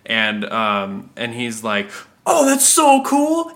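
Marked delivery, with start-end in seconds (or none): 2.2-3.5 much higher, slow, gushing delight; excited customer. Retold or imitated. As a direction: gush like a thrilled kid.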